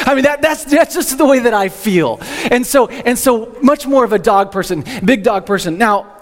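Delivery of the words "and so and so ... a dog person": ordinary talk, nothing marked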